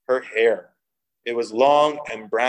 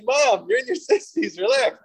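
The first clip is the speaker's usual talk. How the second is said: much higher, pleading to calm mom down; exasperated to mom; 11 semitones higher than usual. Retold or imitated. Son telling his mother to relax, mock-exasperated high voice. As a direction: tell your mom to chill, mock-exasperated. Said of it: "I'm like".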